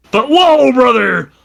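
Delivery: big wrestler's high shout on whoa; Hulk Hogan voice. Retold or imitated. Hulk Hogan catchphrase impression.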